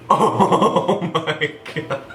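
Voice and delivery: slow, drawn-out oh my god through laughter; giggling at the broken bronzer; laughing disbelief. Real, not acted. Reacting to shattered bronzer.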